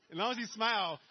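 laughing through the words; chuckling about smiling. Real, not acted. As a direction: says it with a loud laugh. Speaker laughs while explaining.

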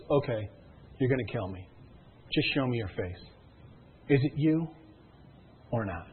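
low, soft, halting confrontation; tense resignation. Acted. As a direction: face the killer quietly, pausing between phrases. Voices heroine facing the masked killer.

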